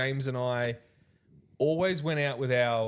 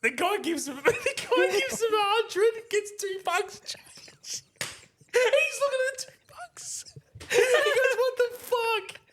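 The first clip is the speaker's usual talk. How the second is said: real high squeaky laughing through the words; helpless laughter. Real, not acted. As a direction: fight to get the story out while cracking up. Helpless laughter telling punchline.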